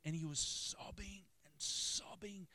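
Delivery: high, slow, breaking into whisper; emotional, airy and stretched; overwrought retelling. Retold or imitated. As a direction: draw out each sobbing, strained and high.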